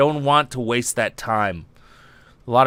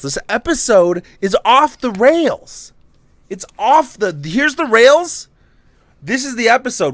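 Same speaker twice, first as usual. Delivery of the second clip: excited; 9 semitones higher, 5 dB louder than usual. Real, not acted. Excited rant about rambling stream.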